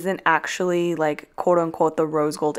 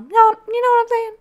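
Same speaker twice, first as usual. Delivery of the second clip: squeaky voice; 6 dB louder than usual. Pretend. Squeaky silly voice.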